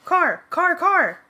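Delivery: loud high alarmed shout; warning yell at the driver. A narrator's reading. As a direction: yell the danger out fast. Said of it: "Jake yelled".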